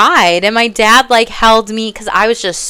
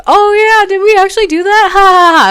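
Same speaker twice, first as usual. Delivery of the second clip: much higher, louder careless cousin voice with fake laugh; mocking cousins; 13 semitones higher, 7 dB louder than usual. Cousins brushing off childhood bullying, high mocking laugh. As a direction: toss it off like a smug cousin. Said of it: "some of them were like".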